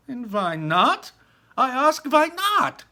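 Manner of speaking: high, loud slow challenge; high loud slow indignant mother; indignant demand. Acted. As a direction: insist on an answer.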